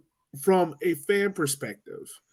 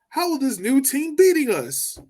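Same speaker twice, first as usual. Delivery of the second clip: mock outraged fan; 11 semitones higher than usual. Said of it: "supporters that are like".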